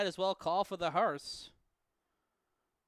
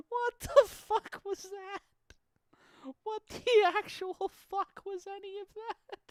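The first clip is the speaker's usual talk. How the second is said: much higher, slowed incredulous ask; stunned disbelief. Real, not acted. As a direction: drag it out in pure disbelief. Disbelief at the replay.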